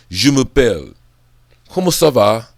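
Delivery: punchy French-accented phrases. Shown as a demonstration. Showing off little phrases in French, French accent. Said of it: "with an amazing accent".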